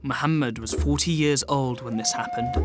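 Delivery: plain reading voice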